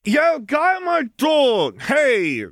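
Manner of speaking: high, drawn-out holler from a rooftop; calling out. A narrator's reading. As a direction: call down loudly to get his attention. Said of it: "Lily called out to the man".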